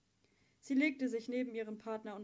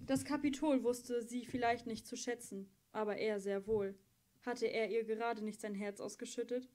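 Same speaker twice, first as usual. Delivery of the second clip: plain reading voice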